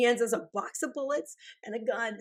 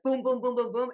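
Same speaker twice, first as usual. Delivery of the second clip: gunshot sounds; 2 semitones higher than usual. Retold or imitated. Imitating rapid gunfire.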